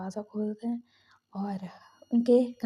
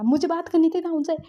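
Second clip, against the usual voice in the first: higher, louder retold protest; desperate protest; 6 semitones higher, 5 dB louder than usual. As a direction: push back desperately.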